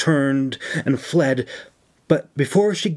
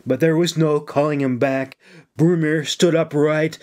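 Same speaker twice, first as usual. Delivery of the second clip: ordinary delivery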